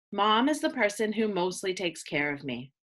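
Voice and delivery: ordinary talk, nothing marked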